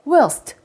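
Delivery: whilst said slowly and clearly, w then -ilst; careful whilst. Shown as a demonstration. Teacher modelling a hard word for children. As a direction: spell out whilst sound by sound. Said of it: "이 발음 되게 어렵던데".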